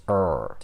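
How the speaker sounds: long, low "er"; stretched R. Shown as a demonstration. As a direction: hold the R sound in "er". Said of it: "you have to stretch those two R letters".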